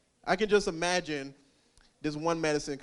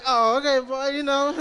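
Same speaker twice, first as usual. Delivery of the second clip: pleased woman; 8 semitones higher, 10 dB louder than usual. Retold or imitated. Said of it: "She like".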